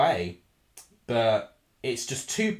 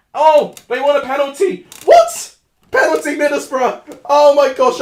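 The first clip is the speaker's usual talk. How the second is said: loud, much higher burst of disbelief; shocked outcry; 11 semitones higher, 12 dB louder than usual. Real, not acted. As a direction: yelp at the sudden penalty. Penalty given mid-rant.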